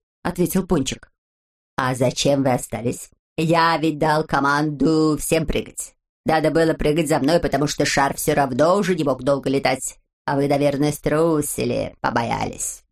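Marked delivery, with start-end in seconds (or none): 1.8-12.8 low, reproachful character voice; low-voiced stern know-it-all; Znayka, sober leader. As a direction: reprove the others in a low, measured voice.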